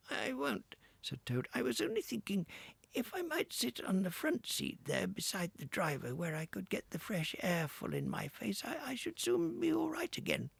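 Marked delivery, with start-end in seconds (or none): 0.1-0.8 Toad as washerwoman. Acted. 1.5-10.5 Toad as washerwoman. Acted.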